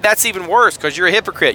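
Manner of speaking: usual speaking voice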